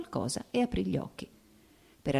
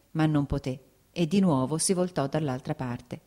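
ordinary delivery, nothing marked

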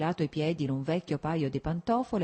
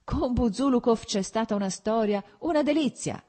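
high, louder, gleeful; excited shout; 6 semitones higher, 4 dB louder than usual. A narrator's reading. Petrichky cries out to Vronsky about Buzulukov, gleeful. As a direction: burst out eager to tell the story. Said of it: "gridò Petrichky".

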